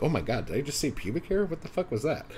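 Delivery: quick, high-pitched mock disbelief; retold startled outburst; shocked at own slip.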